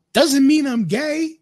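telling his partner off, loud and slow; loud defiant retort. Real, not acted. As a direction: shout it back in defiance.

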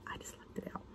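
confessing whisper. Real, not acted. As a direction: whisper it like a secret. Confessing aside, unvoiced.

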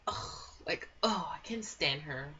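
normal talking voice

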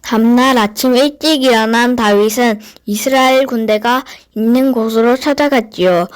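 plain reading voice

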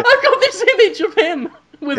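high, loud, laughing through the words. Real, not acted. Guest pitching an absurd picture to the host, laughing. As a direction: crack up as you get it out.

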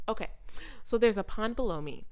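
normal talking voice

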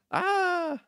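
high-pitched mock scream; thin, high put-on shriek; attempted female scream. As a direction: try a scream in a woman's voice. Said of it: "can't do a scream in the female voice".

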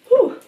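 sudden high breathy whew fighting tears; real tears catching in the breath; tearful high exhale. Real, not acted. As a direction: let a teary breath escape. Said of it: "I don't know why I teared up there".

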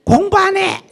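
very high, loud tantrum shriek; child's defiant yell. Retold or imitated. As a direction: shriek the refusal like a sulking kid. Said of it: "이래 나와".

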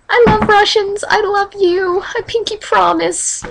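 high-pitched, eager making-up; gushing reassurance. Pretend. Gushing apology after Russian joke.

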